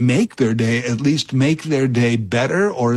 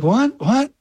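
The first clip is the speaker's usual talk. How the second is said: sharply higher, baffled double what; confused what; 12 semitones higher than usual. Retold or imitated. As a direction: squeak it out in startled confusion. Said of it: "I was like".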